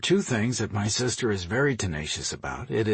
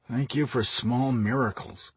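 plain reading voice

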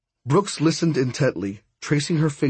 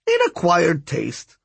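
higher, slow, drawn-out character voice; wry older woman figure; 5 semitones higher, slower than usual. Acted. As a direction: lift it up and stretch it out, dryly. Voices Miss Cab in the story.

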